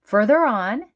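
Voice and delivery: plain reading voice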